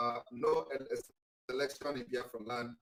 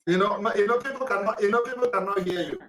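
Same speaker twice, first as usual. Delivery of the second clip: higher and louder, pressing to be heard; loud calling out; 6 semitones higher, 9 dB louder than usual. Real, not acted. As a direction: raise your voice to cut through. Host tells caller he's inaudible.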